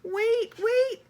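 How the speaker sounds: high, loud desperate calls; pleading high shout of wait, wait; crying out. Acted. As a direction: call after the fleeing animals. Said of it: "she cried".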